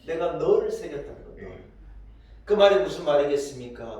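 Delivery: normal talking voice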